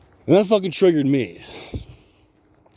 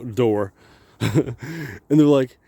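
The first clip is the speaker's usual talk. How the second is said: usual speaking voice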